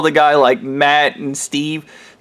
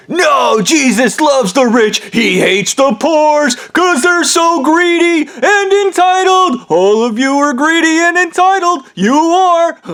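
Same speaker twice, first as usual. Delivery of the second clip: much higher, slow, contemptuous tirade; shrill sneering rant; 11 semitones higher, slower than usual. Retold or imitated. Ham Man ranting at the crowd about Jesus. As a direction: spit it out at the poor.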